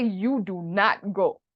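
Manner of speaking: very slow, weighted command; emphatic forbidding. Shown as a demonstration. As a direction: leave no choice, word by word. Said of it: "But if I say".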